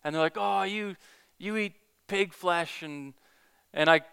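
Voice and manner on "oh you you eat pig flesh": higher, slow sneering taunt; mocking taunt. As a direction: jeer at him like the street teens. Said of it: "mocking me".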